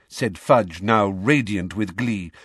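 plain reading voice

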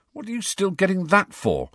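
high, irritated question; irritable. A narrator's reading. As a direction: ask it with annoyed disbelief. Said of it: "said Harry irritably".

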